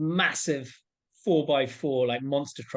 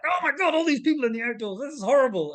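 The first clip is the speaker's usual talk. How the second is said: much higher, hammed-up dismay; mock horror; 13 semitones higher, 4 dB louder than usual. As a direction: play up the comic horror at the crowds. Mock dismay at crowds outdoors.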